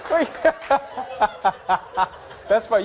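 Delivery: usual speaking voice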